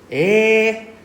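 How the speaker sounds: high, loud, stretched-out eh; long drawn-out eh. Shown as a demonstration. Teacher demos a hesitant, stretched Eh. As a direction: drag out Eh as a thinking filler. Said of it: "I drag out too long".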